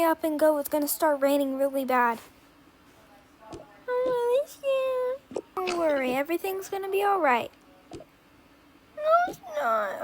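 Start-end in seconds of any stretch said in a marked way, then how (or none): 3.5-5.4 high, slow, whimpering little voice; a frightened kid near tears; scared child. Pretend. Child doll tells her mom she is scared, whimpering high. 7.9-10.0 tearful protest. Pretend. Child doll contradicts reassurance.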